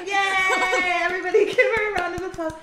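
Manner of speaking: high, loud, fast laughing announcement; laughing cheer. Real, not acted. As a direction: cheer her on mid-laugh.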